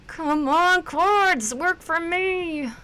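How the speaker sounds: high pleading plea to the cards. Real, not acted.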